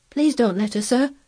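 a touch of anxious entreaty; pleading. A narrator's reading. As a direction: beg the young master gently. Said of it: "pleaded Martha".

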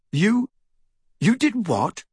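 high, startled questioning; falter, then ask in disbelief; stunned. Acted. Stunned reply to a confession.

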